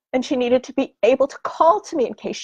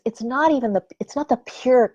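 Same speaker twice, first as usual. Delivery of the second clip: normal talking voice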